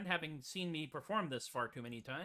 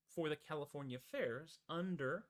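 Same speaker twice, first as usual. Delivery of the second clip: usual speaking voice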